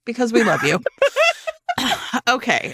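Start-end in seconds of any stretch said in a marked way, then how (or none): 0.8-2.2 high giggle burst; laughing along; bright high laugh. Real, not acted. Laughing after the joke.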